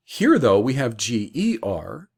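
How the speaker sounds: usual speaking voice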